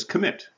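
"commit" with the weight on MIT; stress on second syllable. Shown as a demonstration. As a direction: lean on the last syllable of "commit". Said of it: "the second syllable".

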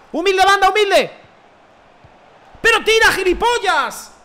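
very high, full-voiced shout; exasperated yelling. Real, not acted. Gamer to teammate who won't shoot, exasperated yelling. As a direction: scream at a useless teammate.

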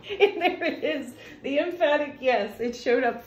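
high, laughing delight at the card. Real, not acted. The card she hoped for appears.

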